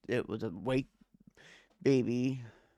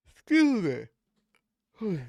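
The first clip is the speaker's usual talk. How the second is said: loud, high yawned words; yawning; 8 semitones higher, 6 dB louder than usual. Real, not acted. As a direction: talk through a yawn. Yawns, then denies being tired.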